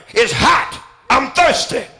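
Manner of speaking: building, rising preacher's cry; swelling sermon climax; full-force preaching. Acted.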